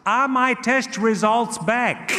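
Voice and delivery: slow, high, emphatic uncle; Gino. Acted. Uncle with mask pulled off asking nephew, slow emphatic delivery. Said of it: "pulls off his face mask".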